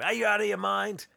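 high, loud furious question; angry Santa. Retold or imitated. Santa raging at Fred. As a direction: scold him hard. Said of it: "He's really angry".

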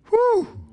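high-pitched whew; amazed "whew". Real, not acted. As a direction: let out a high whoo of amazement. Reacts to the verse he read.

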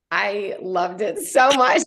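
real delighted laughing while speaking. Real, not acted. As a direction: gush with a laugh in the voice. Gushing about loving the book.

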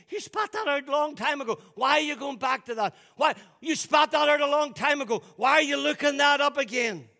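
high, loud preaching shout; shouted rebuke. Real, not acted. Preacher challenging people going back to old sins, shouting. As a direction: drive each question home at full volume.

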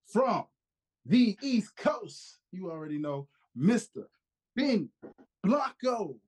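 hyped ring announcer introduction; announcer intro. Acted. Hyped wrestling-style guest introduction.

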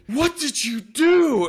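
high, loud, slow alarmed cry; the boss bursting out in panic; panicked. Retold or imitated. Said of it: "comes up to me in a panic".

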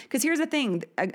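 usual speaking voice

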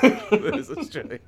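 high, loud laughing repeat. Real, not acted. Laughing after a joke.

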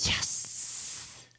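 hushed, secret little cheer; gleeful whisper. Retold or imitated. Kid sees mom isn't looking, gleeful whisper. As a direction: whisper it, thrilled nobody is watching.

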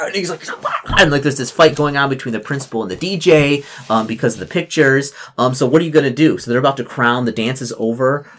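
ordinary talk

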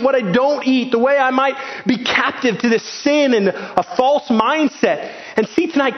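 impassioned high-pitched preaching. Acted. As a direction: preach it with fire and urgency. Preaching at full force.

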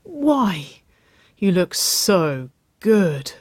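drawn-out, pleading pout; pouting plea. A narrator's reading. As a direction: start high and sink into a sulky groan. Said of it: "her lip jutting in a pout".

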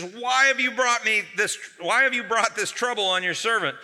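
high, loud exasperated rant; building desperate complaint. Acted. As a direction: vent at God like a worn-out leader. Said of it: "He says".

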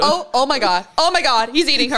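loud, high play-by-play, half laughing; hammed-up horror at an animal attack; panicked mock narration. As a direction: pretend wildlife commentator losing it. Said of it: "And then I'll narrate".

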